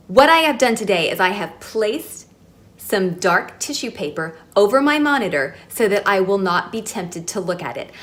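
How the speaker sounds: usual speaking voice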